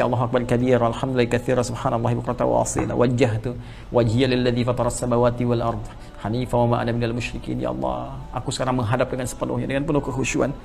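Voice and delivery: soft, hushed recitation and surrender; whisper-close, devoted prayer; devout prayer. Acted. Preacher reciting the opening prayer in hushed devotion.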